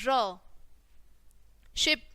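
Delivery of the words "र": र as "ruh", consonant plus short vowel; letter sound demo. Shown as a demonstration. Teacher showing the sound of R, letter sound demo. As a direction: sound out र on its own. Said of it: "sound कैसे आएगी आर की".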